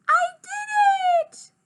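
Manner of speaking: very high, loud, stretched squeal; elated little-kid cheer; triumphant shout. A narrator's reading. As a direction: squeal in victory. Child triumphs after dance.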